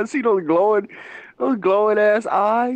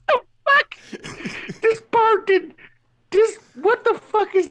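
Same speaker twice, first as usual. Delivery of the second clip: higher, slow, laughing through it; can't get it out for laughing; laughing disbelief; 10 semitones higher, slower than usual. Real, not acted. Retelling the shingle-stealing hawk to a friend, slow laughing disbelief. Bird ripped shingle off gazebo.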